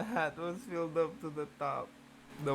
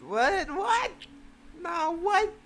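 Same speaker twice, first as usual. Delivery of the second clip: high, loud squawks of disbelief; stunned high squawks; incredulous; 4 dB louder, slower than usual. Real, not acted. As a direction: yelp it, stunned. Disbelief at the text.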